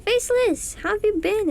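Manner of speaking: excited greeting. Real, not acted. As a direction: greet them with delight. Greeting a stream viewer.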